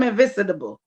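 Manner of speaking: "invincible" said as "in-vin-sid-able"; mispronounced invincible. Real, not acted. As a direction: add an extra "sid" syllable to "invincible". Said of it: "She mean invincible, y'all".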